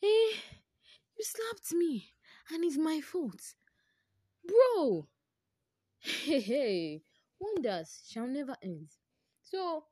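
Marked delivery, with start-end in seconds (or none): 0.0-3.4 outraged exclamation. Retold or imitated. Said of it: "I'm just like". 4.4-7.0 exasperated. Real, not acted. Outraged at the friend's excuse.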